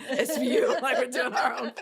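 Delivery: high, loud laughing punchline. Real, not acted. Joking they became crime-show co-conspirators, laughing. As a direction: crack up as you land the joke.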